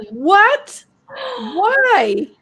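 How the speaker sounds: high, incredulous mock outcry; appalled. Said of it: "I was so mad at him".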